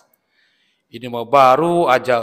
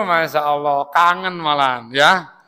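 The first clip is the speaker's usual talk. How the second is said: ordinary delivery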